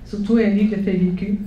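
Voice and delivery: usual speaking voice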